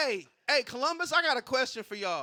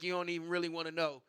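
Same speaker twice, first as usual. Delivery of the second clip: low, fast warning; 6 semitones lower, 6 dB quieter, faster than usual. Retold or imitated. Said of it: "It's like".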